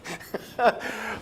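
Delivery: a breathy laugh that rises high; breathy rising laugh. Real, not acted. Host losing it on air, breathy laugh. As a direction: breathe out a giggle.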